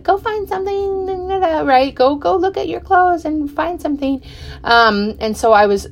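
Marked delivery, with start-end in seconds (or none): 0.0-4.2 high, light voice of her husband urging her on; husband telling his wife to go find something, in a high imitating voice. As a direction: mimic him shooing her off to shop, pitched up. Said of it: "He was always like".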